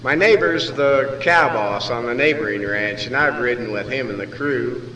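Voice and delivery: ordinary delivery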